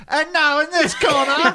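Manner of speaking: slow boxing ring announcer; old-timey boxing announcer. Pretend. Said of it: "came this close to having you the whole thing like".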